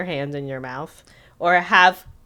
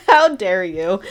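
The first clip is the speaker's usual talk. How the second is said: louder, laughing through mock indignation; laughing outrage; 10 semitones higher, 6 dB louder, slower than usual. Real, not acted. Viewer scolding the fiance, laughing indignation. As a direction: scold him while still laughing.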